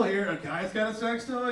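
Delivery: ordinary talk, nothing marked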